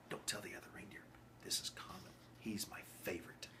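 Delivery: put-on conspiratorial whisper to the child; secret whisper. Santa tells the child a secret in a whisper.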